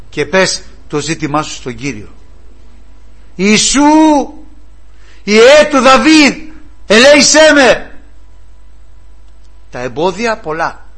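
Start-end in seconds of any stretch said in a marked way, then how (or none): 3.4-8.0 high, loud, slow pleading cry. Acted. Blind Bartimaeus calling to Jesus from the roadside, high pleading shout. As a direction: call to Jesus as a blind beggar begging for mercy. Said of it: "Άρχισε να φωνάζει".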